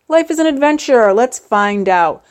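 high, loud, eager put-on voice; hammed-up excited explorer; mock adventurous voice. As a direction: squeal it like a thrill-seeker.

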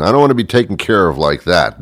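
ordinary talk

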